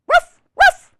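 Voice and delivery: high, loud double woof; puppy woof. Acted. Biscuit answering the reader, high loud puppy woofs. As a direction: yip like an eager little puppy. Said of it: "And he says what?".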